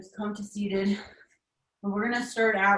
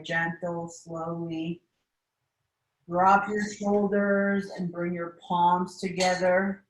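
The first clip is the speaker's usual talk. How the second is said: guided meditation. Acted. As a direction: guide the class through breathing, unhurried. Leads a seated meditation.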